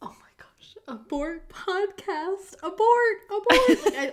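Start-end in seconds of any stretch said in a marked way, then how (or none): 0.0-0.8 shocked whisper. Real, not acted. Shocked by grave story. 0.9-3.8 slow call rising to shouts of abort; mock panic. Panicked joke at gruesome story.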